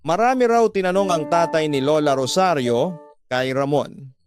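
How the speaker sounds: usual speaking voice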